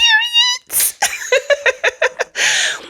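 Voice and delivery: high squealing laugh. Real, not acted. Laughing after period.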